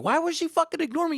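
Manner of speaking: high, hurt voice of his younger self; lost kid wondering why mom ignored him; scared kid. Retold or imitated. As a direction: plead like an abandoned kid. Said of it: "I was like".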